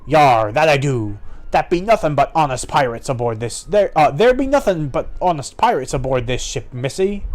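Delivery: pirate. Acted. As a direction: play a pirate protesting innocence. Voices a second pirate.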